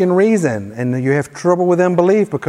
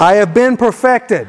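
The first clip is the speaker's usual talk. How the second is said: higher-pitched, emphatic faith declaration; like you mean it; 4 semitones higher than usual. Shown as a demonstration. Preacher leads congregation's repeat-after-me prayer, emphatic and meaning it. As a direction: proclaim it with conviction for others to echo. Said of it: "say it like you mean it".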